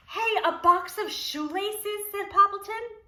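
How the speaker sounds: light up at a treasure; excited discovery. Acted.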